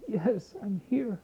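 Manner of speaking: high, slow, soft, sorrowful reply; weeping Hitler. Acted. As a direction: answer like a man weeping, mildly voiced. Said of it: "he's crying and weeping and sad".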